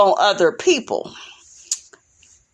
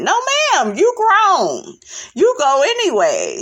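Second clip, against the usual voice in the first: sassy scolding; 7 semitones higher than usual. Real, not acted. Scolding wife over asking permission.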